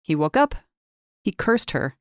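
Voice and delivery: usual speaking voice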